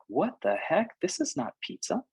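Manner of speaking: "This is not pizza" jumps high in mock shock; mock disbelief. Traveller served strange pizza abroad, mock disbelief. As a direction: play a traveller baffled by foreign pizza. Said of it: "you might be like".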